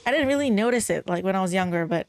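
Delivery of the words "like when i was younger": normal talking voice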